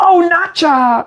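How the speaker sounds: very high, slow, drawn-out squeal; mock bride. The bride reacting at her wedding, hammed-up squealing voice. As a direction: stretch it like a gushing caricature. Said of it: "and she was like".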